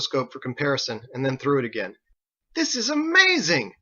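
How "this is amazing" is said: amazed. Acted. Harold amazed.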